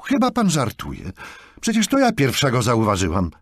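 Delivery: heated counterclaim, voice lifted; angry protest. A narrator's reading. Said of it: "Złość podkreśliła jej angielski akcent".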